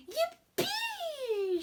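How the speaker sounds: high squeal of joy; high joyful yippee; excited shout. Acted. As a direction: shriek it with delight. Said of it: "she shouted with excitement".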